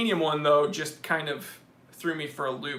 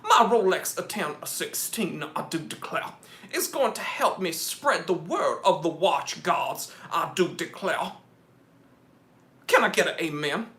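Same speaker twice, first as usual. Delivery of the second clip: mock Southern gentleman sermonizing; lightly hammed-up Southern declaring; Foghorn Leghorn. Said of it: "like, a foghorn leghorn".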